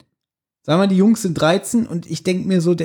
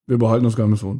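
low, drained and listless, laughing through it; mock sick Justus; 7 semitones lower, much faster than usual. Mocking a worn-out Justus in the radio play, low and laughing. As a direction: sound too sick and weary to talk. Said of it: "Das passt nicht zu Justus".

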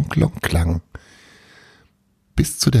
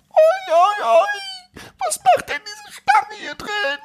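tiny creature wail; slower than usual. Acted. Tiny voice behind door.

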